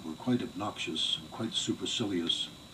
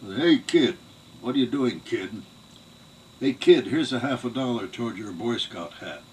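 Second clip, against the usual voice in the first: loud, higher brash Bronx-style patronizing; mocking city relatives; 4 semitones higher, 9 dB louder than usual. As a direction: talk down to the kid like a flashy city uncle. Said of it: "this awful New York accent, Bronx accent".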